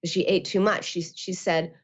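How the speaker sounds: ordinary delivery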